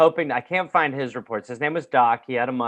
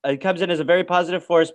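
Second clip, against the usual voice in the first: normal talking voice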